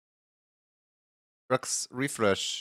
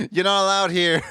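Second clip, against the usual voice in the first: squeaky playful yell, much higher and louder; mock outrage; 9 semitones higher, 6 dB louder than usual. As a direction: banish them with a high teasing shout. Teasing a chatter who showed up.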